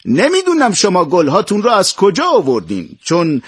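high-pitched, emphatic storybook announcement; loud proclamation. A narrator's reading. Emperor announces his successor to all the children, in a high proclaiming voice. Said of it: "با صدای بلند گفت".

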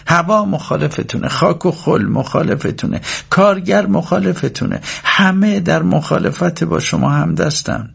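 high-pitched, emphatic mother's lecture; insistent speech, slowing toward the end; mother holding forth. A narrator's reading.

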